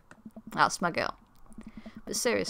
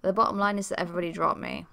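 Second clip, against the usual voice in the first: ordinary delivery, nothing marked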